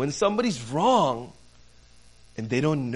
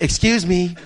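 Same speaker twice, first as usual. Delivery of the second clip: louder, brief "excuse me"; polite interruption; 3 semitones higher, 7 dB louder than usual. Retold or imitated. Employee interrupting a customer's game, first attempt, firm but contained. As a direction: imitate an employee butting in.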